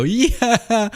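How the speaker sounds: high, drawn-out exultant whoop; mock overjoyed cry. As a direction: whoop with hammed-up movie-climax joy. Acting Tim hearing she survived.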